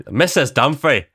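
loud, indignant mock Northern Irish accent. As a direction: imitate an offended Northern Irish sketch character, hammed up. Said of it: "one of them's Northern Ireland".

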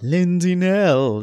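a hint of Pat Carroll, slow and drawn out. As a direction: stretch the name slowly like Ursula's actress. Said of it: "That was my Pat Carroll voice".

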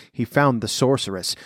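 ordinary delivery, nothing marked

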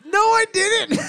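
high, loud, laughing denial; squealed protest. Real, not acted. Host admitting he missed the joke, high laughing protest.

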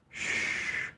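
hushing shh, then a low, soothing stop; calming a baby. Acted. Preacher voicing Jesus calming frightened disciples, soothing hush. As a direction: hush them as you would a crying baby. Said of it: "calmed down a crying baby".

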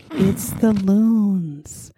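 slow, hushed, dreamy mock librarian; hushed, airy parody of a prissy friend; prim nature lover. Mocking friend's prim nature talk.

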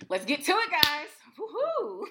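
high, loud, hyped-up; too high. Real, not acted. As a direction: pump up the audience. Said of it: "See, you're too high".

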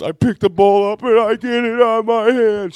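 very high, loud grandma wail; imitated hysterical tearful outburst; mock crying wail. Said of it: "She starts crying".

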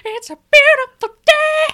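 very high, loud screech; rock singer talking. Acted. Joining the bit of a rock singer chatting, screeching impression. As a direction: shriek pleasantries like a rock frontman. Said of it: "what does he sound like when he talks".

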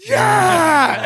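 high, loud cheering yell; crowd cheer. Retold or imitated. Kids at the retreat cheering back, high loud yell. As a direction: cheer back like the whole room.

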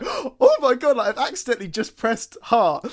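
loud, high panicked inner voice. Retold or imitated. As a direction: blurt it out in alarm. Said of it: "I was sat there like".

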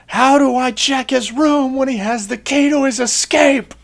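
high, loud outraged rant; screaming corporal. Retold or imitated. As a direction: scream it like an NCO tearing into a recruit. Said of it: "is just him screaming".

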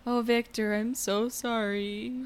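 soft, slow, mumbled line reading; mock her murmured line; mimicking flat delivery. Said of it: "just going".

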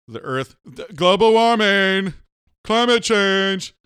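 loud, slow grand orator voice; dramatic speech. Pretend. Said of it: "See the powerful thing I'm doing".

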